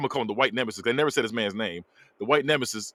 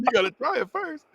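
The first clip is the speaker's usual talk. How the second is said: very high-pitched imitation; mock high insistent voice; hammed-up punchline; 11 semitones higher than usual. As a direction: repeat it way up high for the laugh. Repeats the punchline quote.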